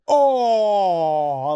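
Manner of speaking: long drawn-out "oh", sliding down; drawn-out mock groan; imitated winded "oh". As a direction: imitate a crowd-heard groan from a blow. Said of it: "like that".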